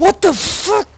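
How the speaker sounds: high startled shriek. Real, not acted. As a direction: yelp it out in shock. Hand monster chasing player.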